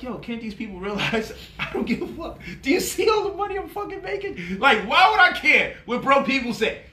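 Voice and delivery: laughing boast about money to the haters; gleeful rant. Retold or imitated. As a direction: crow about not caring, way up high. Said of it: "I be like".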